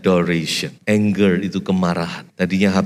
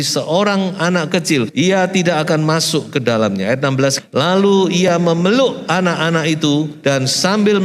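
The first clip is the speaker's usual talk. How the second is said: scripture reading; 6 semitones higher than usual. Acted. Preacher reading Jesus blessing children, raised reading voice. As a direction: proclaim the verses to the congregation. Reads Mark 10 aloud.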